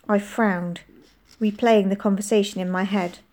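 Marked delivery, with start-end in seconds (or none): none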